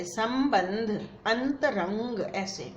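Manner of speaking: संबंध and अंतरंग very slowly, each syllable separate; teacher slowing संबंध for a foreign learner; slow spelled-out reading. Shown as a demonstration. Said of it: "इस तरह से लिखा जाए".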